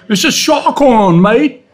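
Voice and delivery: high, loud mock Australian holler; screaming Aussie. Aussie calling to a swimmer, loud imitated Australian shout. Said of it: "one Aussie, he screams at me".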